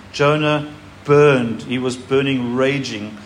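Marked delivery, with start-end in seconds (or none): 0.1-1.6 "burned" stretched long and pressed; drawn out burned. Shown as a demonstration. Preacher reading scripture to congregation, slow heavy stress on "burned". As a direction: lean hard on "burned", slowly. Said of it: "Another version says".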